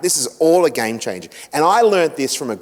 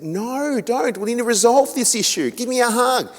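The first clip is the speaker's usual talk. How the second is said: much higher, pleading voice; 10 semitones higher than usual. Retold or imitated. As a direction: beg her to stay and hug it out. Husband pleads with wife.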